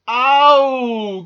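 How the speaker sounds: high, long howled "ow"; loud cry of pain. A narrator's reading. Squirrel lands in cabbage patch, full-voiced yelp. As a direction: yell at the top of your voice. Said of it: "at the top of his voice".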